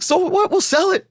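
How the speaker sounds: high, breezy mock wife voice; imitating his wife. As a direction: toss it off high like her. Wife voiced in retold story.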